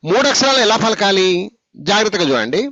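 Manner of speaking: normal talking voice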